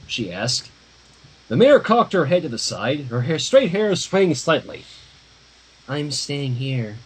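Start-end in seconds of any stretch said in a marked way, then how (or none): 5.9-7.0 deadpan Maud. Acted. Maud answers the mayor.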